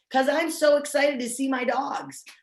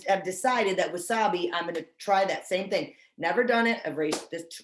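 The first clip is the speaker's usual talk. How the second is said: ordinary talk, nothing marked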